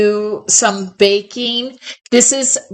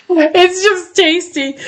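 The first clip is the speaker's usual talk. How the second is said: giggly, higher, delighted; laughing; 7 semitones higher than usual. Real, not acted. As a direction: say it through a laugh. Laughing about the eclair.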